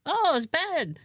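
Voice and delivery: high, lightly mimicked handyman; imitating Louis. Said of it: "And he goes".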